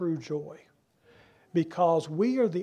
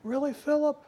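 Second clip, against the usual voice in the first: a light, raised "Really, Philip?" in mock disbelief; incredulous; 6 semitones higher than usual. Jesus answering Philip's question, mildly incredulous. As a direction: gently chide him as if you can't believe it. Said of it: "he's kind of like".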